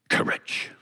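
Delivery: breathy whisper; hushed wish. Acted. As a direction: whisper it like a precious secret. Lion names his secret wish.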